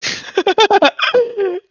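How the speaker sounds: high-pitched burst of laughter; laughing. Real, not acted. Game master cracks up at the table, high laughing. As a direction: break into a high giggle.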